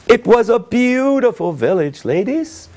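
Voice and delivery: high, bright story narration voice; lively storytelling. A narrator's reading. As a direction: tell it like a fairy tale to kids.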